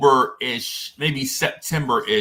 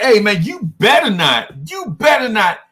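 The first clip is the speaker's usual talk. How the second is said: mock warning; 6 semitones higher, 4 dB louder than usual. Warning friend before name.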